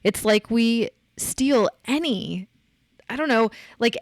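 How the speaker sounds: normal talking voice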